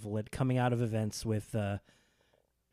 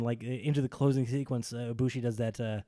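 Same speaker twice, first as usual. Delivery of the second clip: ordinary delivery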